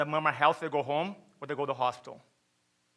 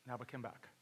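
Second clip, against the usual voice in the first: very soft and lower; hushed somber echo; 5 semitones lower, 19 dB quieter than usual. Real, not acted. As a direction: let it drop almost to nothing. Somber echo of painful fact.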